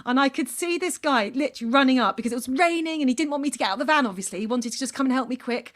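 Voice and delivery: ordinary delivery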